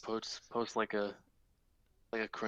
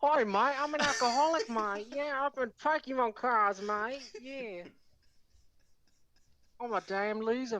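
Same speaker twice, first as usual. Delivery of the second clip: Max Mofo impression; 11 semitones higher, slower than usual. Impersonating an Australian Pokemon card YouTuber for a friend, high and hammed-up. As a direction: ham up a high squeaky Aussie YouTuber. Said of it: "I could do Max Mofo".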